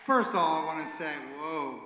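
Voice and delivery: booming excited opener; loud. Real, not acted. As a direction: belt it out to the room. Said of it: "I'll hold it back a little bit".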